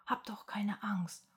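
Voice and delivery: soft, coaxing whisper; hushed, gentle pleading. A narrator's reading. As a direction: whisper to soothe a frightened animal. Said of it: "flüstert Pippa".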